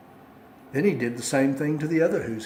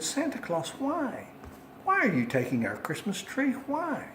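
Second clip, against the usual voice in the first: put-on little girl voice; 8 semitones higher, 3 dB quieter than usual. Small child questions the Grinch.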